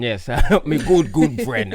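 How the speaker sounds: high, loud laughing Percy impression; laughing accent imitation. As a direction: laugh through a broken English catchphrase. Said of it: "And they always say".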